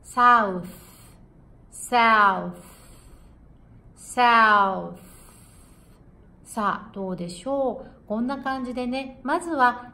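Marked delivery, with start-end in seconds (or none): 0.0-5.0 South ending on a voiceless th; th demo for South; TH drill. Shown as a demonstration. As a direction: say South loud, tongue between teeth at the end. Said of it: "南です。".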